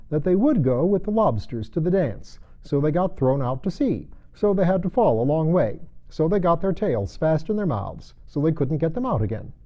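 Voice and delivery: high, brisk Gryphon. Acted. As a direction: rattle off the explanation.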